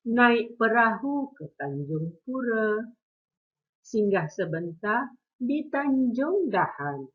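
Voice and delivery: slow, expressive pantun recitation; teacher reciting a pantun for the class; pantun recital. Shown as a demonstration. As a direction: recite each line slowly with feeling. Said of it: "dengar intonasi cikgu dan penghayatan cikgu semasa melafazkan pantun ini".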